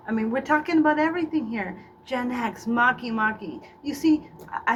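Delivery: ordinary talk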